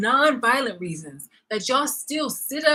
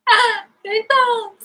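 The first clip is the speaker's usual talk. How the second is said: voice breaking; 13 semitones higher, 8 dB louder, slower than usual. Real, not acted. Voice breaks in frustration.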